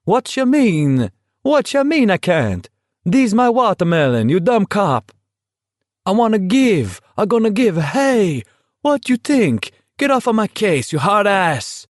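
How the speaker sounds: put-on high, indignant Italian-accented protest; a fruit seller in a broken-English Italian accent, yelling at the cop; loud Italian accent. Italian fruit seller yelling at state troopers in a high, loud Italian accent. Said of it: "whose voice came loud and clear".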